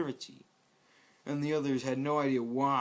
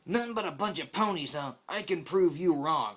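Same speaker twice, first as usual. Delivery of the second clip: cocky challenge; 4 semitones higher, 6 dB louder than usual. Acted. Rainbow Dash challenges Scout.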